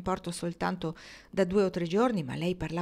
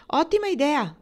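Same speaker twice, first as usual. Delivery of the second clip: high, louder approving exclamation; hearty approval; 8 semitones higher, 5 dB louder than usual. A narrator's reading. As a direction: jump in with keen approval. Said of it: "approvò Fenway". (approvazione entusiasta, voce alta)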